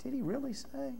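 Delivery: high, soft, insinuating tempter; lightly voiced devil planting doubt; sly Satan. Satan whispering doubt to Eve, high and soft. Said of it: "But Satan said".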